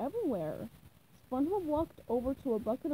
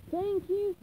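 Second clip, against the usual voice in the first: higher, louder thank you; beaming at customers, pitched up; eager thanks; 8 semitones higher, 4 dB louder than usual. Acted. Krabs thanking departing customers.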